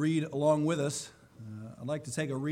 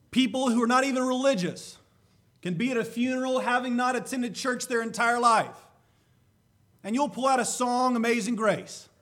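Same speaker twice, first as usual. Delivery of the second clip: higher and louder, pressing each point home; impassioned preaching; 11 semitones higher, 7 dB louder than usual. Acted. Preacher tells the church about Amazing Grace, fervent and raised. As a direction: build the sermon with fervour.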